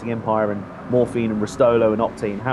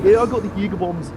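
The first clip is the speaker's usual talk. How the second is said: bad Scottish accent; 5 semitones higher than usual. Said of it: "that's the worst accent you'll ever hear".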